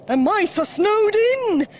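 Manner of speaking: loud, high alarm; urgent announcement. A narrator's reading. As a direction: raise the alarm as a storybook bear. Bear raises alarm in story.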